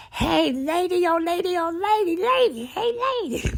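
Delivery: high, loud repeated calling of lady; imitating a holler. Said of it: "her mom went".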